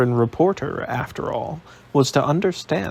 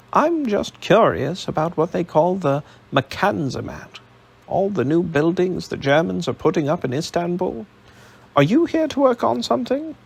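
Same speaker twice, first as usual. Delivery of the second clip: higher, bright-voiced American reporter; eager American accent; 6 semitones higher than usual. A narrator's reading. Reporter introduces himself to Alec, eager American accent. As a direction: pitch it up, keen New York reporter.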